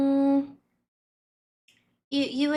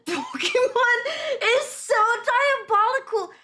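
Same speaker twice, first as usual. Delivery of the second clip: laughing; 7 semitones higher, 4 dB louder than usual. Real, not acted. Laughing at Pokemon ad.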